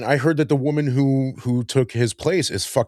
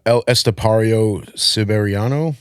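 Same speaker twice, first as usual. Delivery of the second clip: slow, unrolled American pronunciation; American accent; 3 semitones lower than usual. Real, not acted. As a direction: sound out the Spanish name in a plain American accent. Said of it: "I said that in the whitest way possible".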